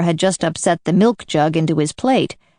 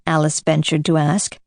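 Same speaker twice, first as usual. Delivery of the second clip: ordinary delivery, nothing marked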